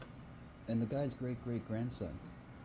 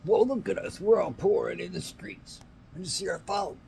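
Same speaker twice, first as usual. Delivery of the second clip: high put-on whiny complainer; mocking whiner; 10 semitones higher, 5 dB louder than usual.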